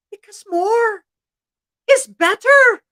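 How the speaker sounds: high, emphatic matter-of-course reply; stating the obvious. Acted. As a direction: say it as if anyone should know. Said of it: "as if stating the obvious".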